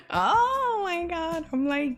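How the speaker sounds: stretched out in emotional disbelief; overwhelmed. Real, not acted. Overwhelmed by supporters' kindness.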